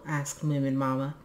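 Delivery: plain reading voice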